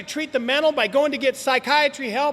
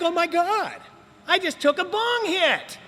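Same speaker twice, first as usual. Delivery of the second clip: shocked realization; 6 semitones higher than usual. Retold or imitated. Realizing he relapsed on weed.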